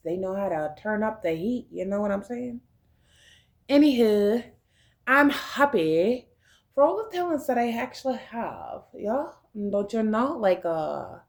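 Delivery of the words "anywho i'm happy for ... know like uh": put-on, uncertain Russian-ish accent; vague Russian-like accent. Happy about their talents, in a makeshift Russian-like accent. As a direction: slip into a loose foreign accent, roughly Russian. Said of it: "I don't even know what accent I'm speaking now".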